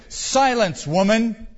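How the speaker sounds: loud, high rebuke; angry cry. A narrator's reading. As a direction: shut her up with a forceful order. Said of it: "cried one of the cave dwellers".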